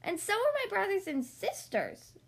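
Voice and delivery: high little puppy voice; puppy answering the cat. A narrator's reading. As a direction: sound small and eager like a pup. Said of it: "cried Lucky".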